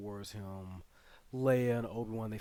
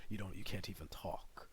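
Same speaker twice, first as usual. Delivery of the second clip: whispered quote; 3 semitones lower, 8 dB quieter than usual. Retold or imitated.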